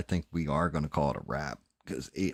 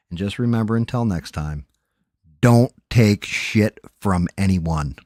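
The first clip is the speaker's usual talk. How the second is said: loud sign-off; 3 semitones higher, 10 dB louder than usual. Real, not acted. Sign-off shouted at the chat.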